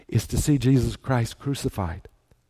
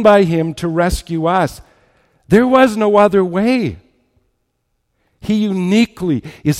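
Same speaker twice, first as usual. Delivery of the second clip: raised pitch, driving sermon delivery; impassioned preaching; 5 semitones higher, 5 dB louder than usual. Acted. Preacher to congregation about the cross, impassioned preaching. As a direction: preach it with rising force and emphatic pauses.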